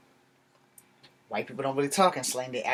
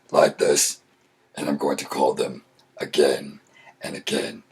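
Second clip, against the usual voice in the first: disguised voice; 12 semitones lower than usual. Shown as a demonstration. Planning to prank the callers back, disguised deep voice. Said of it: "I'm going to change my voice".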